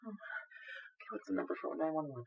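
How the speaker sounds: breathy panicked whisper. Acted. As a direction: mutter to yourself in panic. Panicked muttering to self.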